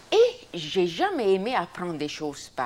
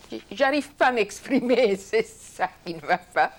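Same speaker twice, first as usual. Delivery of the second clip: raised voice breaking into laughter; laughing protest; 5 semitones higher, 7 dB louder than usual. Retold or imitated. Explaining she cannot express herself in English, laughing protest. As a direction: protest loudly, laughing through the words. Laughing over her retold language trouble.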